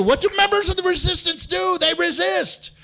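rally the crowd with rhymes; rousing shout. Acted. Rousing rhyming chant to the crowd.

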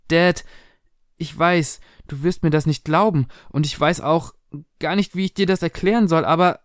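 put-on worried girl struggling to explain; anxious daughter. Anxious daughter tells her father.

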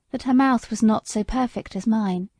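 plain reading voice

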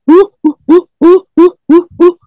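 very high, loud imitated whoops; monkey noises. Retelling what his ex heard running off, high monkey whoops. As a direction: whoop like a scared chimp fleeing uphill. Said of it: "She said it was going".

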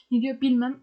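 ordinary talk

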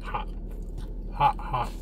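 chewing while talking; speaking between hot bites; mouth full of pizza. Real, not acted. Seen 0: Bites pizza at 0.8 seconds, then chews with a full mouth throughout.

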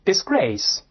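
disgrace with the stress on GRACE; stress on second syllable. Shown as a demonstration. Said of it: "重音还是在第二个音节". (disgrace，重音放在第二个音节)

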